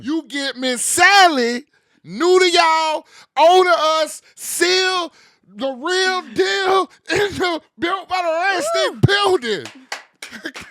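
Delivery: loud, slow, high hype yell; hype man shout. Acted. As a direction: bring the guest out like a champion. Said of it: "the best hype man in this damn country".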